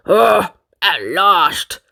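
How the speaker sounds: slow, grumpy grumble; grumbling. Acted. Mister Grumble grumbles at the waitress. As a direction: drag it out like a grump. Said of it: "grumbled Mister Grumble".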